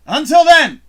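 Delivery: loud, high showman call; announcer sign-off. Acted. Host closing his show in a loud announcer call. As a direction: sell the outro like a host.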